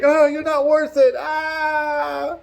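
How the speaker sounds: high, loud squeal while running off; fleeing coward. Retold or imitated. Guard fleeing the fight, high mock squeal. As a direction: yelp like a coward bolting. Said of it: "He's like".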